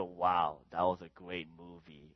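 low and muted, zero enthusiasm; unenthusiastic. Acted. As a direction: praise it without any feeling. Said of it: "do you talk that way?".

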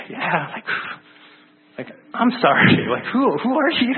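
apologize, then ask in disbelief who he is; embarrassed puzzled question; confused asking. Retold or imitated. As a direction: voice rises high on who are you, baffled.

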